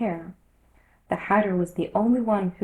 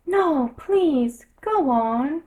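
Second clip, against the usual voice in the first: pleading Alice; 7 semitones higher, 5 dB louder, much slower than usual. A narrator's reading.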